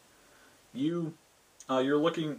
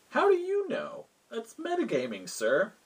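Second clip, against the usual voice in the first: very high-pitched playful accusation; squeaky mock indignation; mock incredulous challenge; 12 semitones higher than usual. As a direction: call out the cheat in a high, incredulous voice. Game master mock-challenges metagaming player.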